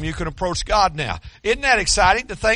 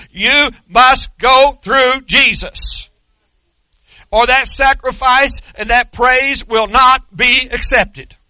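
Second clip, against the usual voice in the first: slow and louder, each word hammered; preacher driving the point home, slow and loud; emphatic preaching; 4 semitones higher, 5 dB louder, slower than usual. Acted. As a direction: pound it out from the pulpit.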